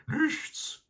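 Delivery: tiny very high squeaked answer; high-pitched hound voice; talking dog. A narrator's reading. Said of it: "sagte der Hund".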